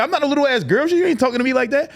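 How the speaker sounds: high, indignant retelling of a retort; mock-indignant, pitched well up; arguing. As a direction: imitate an offended woman standing her ground. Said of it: "they get to arguing".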